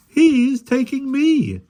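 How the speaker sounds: high, slow, loud joyful cry. A narrator's reading. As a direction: cry out happily. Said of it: "cried the second policeman".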